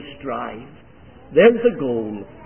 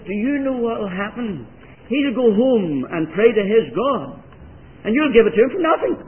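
higher and louder, rushing the last line; emphatic warning; 7 semitones higher, 5 dB louder than usual. Retold or imitated. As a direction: warn him, building to a quick punch. Lawyer warning his colleague.

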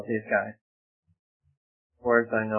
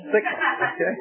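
laughing; 13 semitones higher than usual. Real, not acted. Amused at the six Bibles.

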